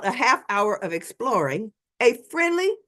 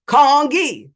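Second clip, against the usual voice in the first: Congee said louder and slower, KAHNG-kee; 4 semitones higher, 9 dB louder than usual. Retold or imitated. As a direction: spell out Congee loud and slow to a tourist. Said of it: "saying the word louder and slower".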